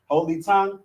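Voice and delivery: ordinary talk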